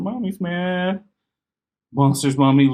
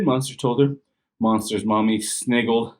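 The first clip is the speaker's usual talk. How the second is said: ordinary delivery, nothing marked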